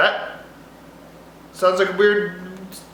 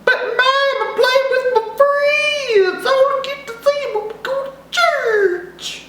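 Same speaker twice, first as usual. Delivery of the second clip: mock Italian accent.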